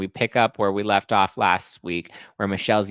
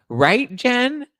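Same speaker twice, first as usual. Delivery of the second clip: mock call out; 7 dB louder than usual. Mock excited call to Jen.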